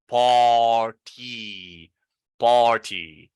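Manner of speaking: "party" stretched out, long "par" then separate "tee"; party, sounds divided. Shown as a demonstration. Teacher showing learners the symbols, drawn-out pronunciation. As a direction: draw out each syllable of "party". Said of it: "you divide the sounds".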